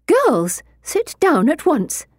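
high, drawn-out reprimand; scolding chaperone. A narrator's reading. Chaperone to girls out of their seats, high scolding command.

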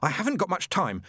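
high, urgent, half-contained shout; hissed shout. Acted. Angel begs demon to listen, hissing and shouting. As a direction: press him urgently without fully shouting. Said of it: "tried to hiss and shout at the same time".

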